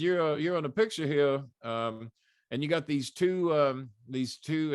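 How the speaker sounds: a hint of Southern general, halting. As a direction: imitate a Southern general, slowing down as he goes. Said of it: "he talked like a Southern general".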